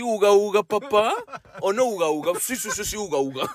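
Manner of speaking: high goofy caveman voice. Pretend. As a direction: grunt it like a caveman. Playing cavemen together.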